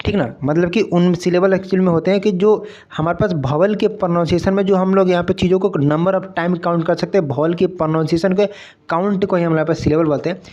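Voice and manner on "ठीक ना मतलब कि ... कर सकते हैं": normal talking voice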